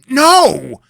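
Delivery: high, loud, furious yell; irate tantrum no. Acted. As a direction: shout it like an irate two-year-old. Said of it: "stamping his foot ... irate two-year-old".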